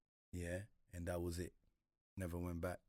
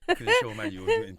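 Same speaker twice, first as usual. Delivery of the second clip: laughing; 14 dB louder than usual. Real, not acted. Laughing while recalling Primark shame.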